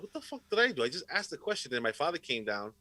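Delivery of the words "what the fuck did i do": high-pitched, baffled retold outburst; retold disbelief. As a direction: replay a kid's stunned protest. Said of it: "I was like".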